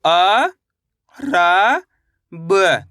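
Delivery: each letter drawn out, with a long pause after it; stretched, separated syllables. Shown as a demonstration. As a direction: sound out the letters one at a time for learners. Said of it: "मेरे साथ साथ इनको repeat".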